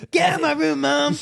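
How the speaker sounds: high-pitched mock American accent; hammed-up American kid voice yelling at Mom; American twang. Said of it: "he had that kind of twang".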